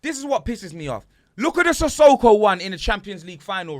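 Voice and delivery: loud, high furious rant; really angry. Real, not acted. Ranting to his co-host about the Sissoko handball, loud and high. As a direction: let the fury out at the ref. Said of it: "but I'm really angry now".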